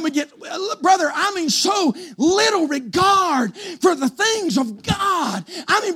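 high, drawn-out pulpit cadence; full-force preaching. Real, not acted. Preacher lamenting little regard for God, full-force preaching. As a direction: lean hard and long on each phrase.

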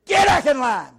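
loud, high barked order; drill sergeant shout. Retold or imitated. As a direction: bark it to dismiss the recruit.

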